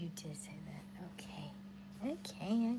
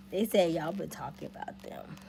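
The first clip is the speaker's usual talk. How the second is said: usual speaking voice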